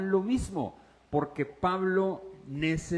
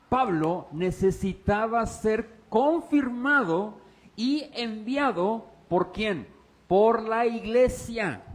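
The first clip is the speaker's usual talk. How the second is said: slow, louder stressed points; emphatic preaching; 4 semitones higher, 5 dB louder, slower than usual. Acted. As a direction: drive the point home. Predicación enfática. (predicación enfática y lenta)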